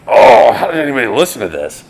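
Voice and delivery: high, loud mock horror; mock cringe. Musician mocking his own old recordings, loud mock horror. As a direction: exaggerate your disgust at your old record.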